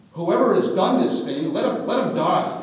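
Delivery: loud, high indignant vow; indignant oath. Acted. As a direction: swear it at full voice. Brothers swear their innocence.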